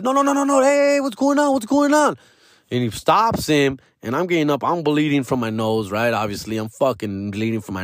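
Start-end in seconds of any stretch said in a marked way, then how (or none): 0.0-2.2 high, urgent peacemaker voice; a friend rushing in to stop the fight, high pitched; friend breaking it up. Retold or imitated. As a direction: pull a fighter off, high and fast.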